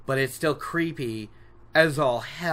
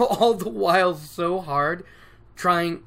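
ordinary talk

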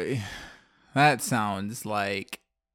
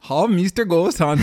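loud, higher, badly faked Finnish accent; 6 semitones higher, 8 dB louder than usual. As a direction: botch a Finnish accent at full volume. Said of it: "I can't do a Finnish accent".

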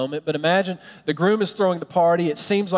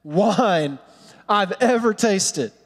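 slow, higher-pitched rave, word by word; excited master of the feast; 4 semitones higher, much slower than usual. Acted. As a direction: gush over it with weighty pauses. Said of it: "I just think of somebody just excited".

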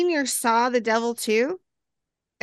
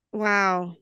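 normal talking voice

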